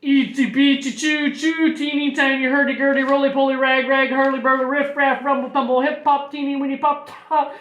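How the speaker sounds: loud chant gathering speed; accelerating string of nonsense words; slow, then speeding up. A narrator's reading. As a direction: start drawn out, then race along. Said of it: "started slowly, but picked up speed".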